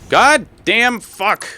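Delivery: slow, high, loud groaning curse; drawn-out frustrated curse. Real, not acted. Gamer cursing at his game, slow pained groan. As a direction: drag the curse out in real frustration. Frustrated outburst while gaming.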